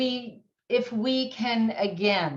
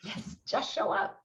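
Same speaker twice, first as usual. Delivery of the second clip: quiet, almost breathed answer; soft; 7 dB quieter than usual. Real, not acted. Answering how to support grievers, soft and hushed. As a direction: hush it, just show up. Answering the key question softly.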